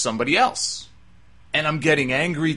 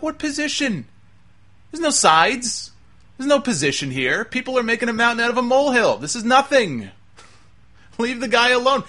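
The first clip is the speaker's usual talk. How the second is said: high-pitched, indignant protest; fed-up, incredulous outburst; exasperated retort; 9 semitones higher than usual. Retold or imitated. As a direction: raise your pitch and argue back in disbelief. Exasperated reply to complaining fans.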